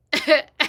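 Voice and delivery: loud, high burst of laughter. Real, not acted.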